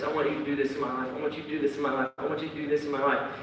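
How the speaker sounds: soft, fast, pleading murmur; muttered repeated prayer. Retold or imitated. Believer nagging Jesus for wants, soft fast murmur. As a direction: rattle off the wish like a nagging prayer.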